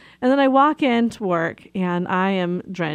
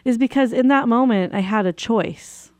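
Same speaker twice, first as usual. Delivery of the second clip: usual speaking voice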